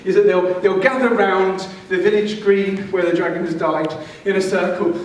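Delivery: ordinary delivery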